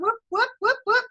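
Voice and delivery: party whoops for a winner; whooping cheer. Real, not acted. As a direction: celebrate with a quick whoop chant. Celebrating a star.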